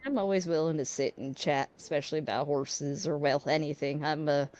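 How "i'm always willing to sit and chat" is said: ordinary talk, nothing marked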